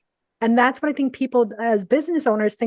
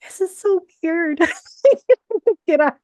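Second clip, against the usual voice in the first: giddy disbelief; 8 semitones higher, 5 dB louder than usual. Retold or imitated. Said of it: "I'm still going there going".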